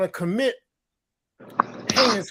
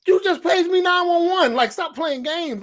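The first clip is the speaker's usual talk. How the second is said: higher-pitched mock exasperation; acted annoyed scolding, pitched up; exasperated; 9 semitones higher than usual. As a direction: protest at being needlessly alarmed. Acted annoyance at false emergency page.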